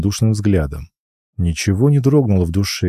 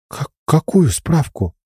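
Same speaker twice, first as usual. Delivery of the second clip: high, slow confused exhale; breathy bewildered girl; 8 semitones higher than usual. Acted. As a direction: breathe it out, baffled. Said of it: "Выдохнула она".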